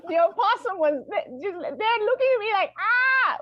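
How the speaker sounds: high, loud frightened retelling; rising into a startled cry; panicked housekeeper. Retold or imitated. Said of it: "She's like".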